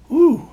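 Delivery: high, loud "whew"; sudden high-pitched whew; exclaimed whew. Real, not acted. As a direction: let out a high whoop of overwhelm. Overwhelmed by mixed-up modal music.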